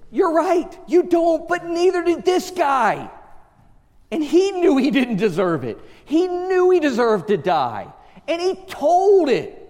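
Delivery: high, forceful preacher voice; full-force preaching. Real, not acted. Preacher answering "I don't deserve it", full-force preaching. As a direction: press the argument hard from the pulpit.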